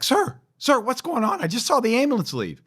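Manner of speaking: high, urgent mock panic; alarmed sergeant. First sergeant running up to the commander, high alarmed voice. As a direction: play the worried sergeant rushing over.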